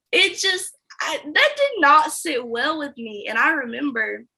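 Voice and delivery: ordinary delivery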